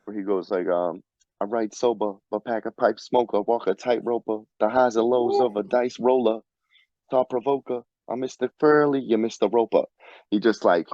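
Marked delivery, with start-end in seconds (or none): 1.4-9.8 put-on rhythmic recital in a Rhode Island accent. Friend quoting bars that skip every R, Rhode Island accent. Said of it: "a Rhode Island accent in the wild".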